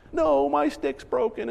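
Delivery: lightly voiced sulking little girl; mock sulky child. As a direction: whine it like the daughter. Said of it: "she's crying".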